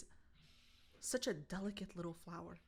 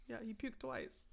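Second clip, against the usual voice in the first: ordinary delivery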